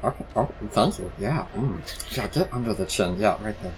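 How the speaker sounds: the frog sinks into contented pleasure, then nearly whispers; blissful scratch. Acted. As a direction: purr like a pet getting its chin scratched. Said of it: "And he goes".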